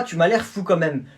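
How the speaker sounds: normal talking voice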